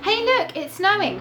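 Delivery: high, loud delighted cry; excited call. A narrator's reading. Reading to children, calling out that it's snowing with delight. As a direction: point out the snow with glee.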